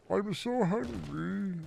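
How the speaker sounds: high, quiet, slow whine; Zach Hadel impression. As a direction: whimper it like a starving cartoon. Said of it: "Zach Hadel".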